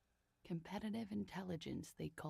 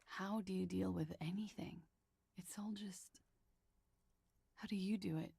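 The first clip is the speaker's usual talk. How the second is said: breathy unvoiced whisper; 3 semitones higher than usual. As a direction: whisper it in despair. Despairing question to herself.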